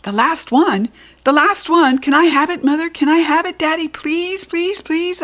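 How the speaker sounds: high, pleading please; child begging parents for the swim ring; urgent begging. Acted. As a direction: beg fast and urgent, high voice. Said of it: "very urgent".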